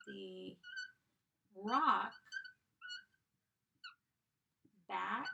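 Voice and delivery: each word said slowly, one at a time; slow dictation. Shown as a demonstration. Teacher dictating a sentence word by word, slowly. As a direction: dictate it slow with long pauses. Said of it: "All right, the last sentence".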